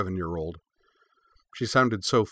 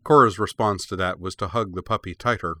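ordinary delivery

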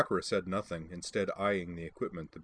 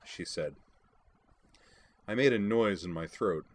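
plain reading voice

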